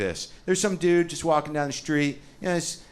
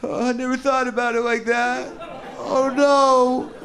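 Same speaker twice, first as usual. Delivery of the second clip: high, slow, loud mock despair; hammed-up slow, loud mock wail; crushed believer; 9 semitones higher, 5 dB louder, slower than usual. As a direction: wail it like your faith just collapsed. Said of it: "the guy just goes".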